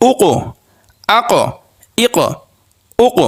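উক, আক, ইক each ending in a bounced ক release; qalqalah bounce. Shown as a demonstration. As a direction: jolt the closing ক of every syllable. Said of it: "একটু ধাক্কা দিয়ে উচ্চারণ করতে হয়".

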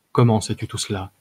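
plain reading voice

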